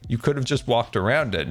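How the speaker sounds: ordinary delivery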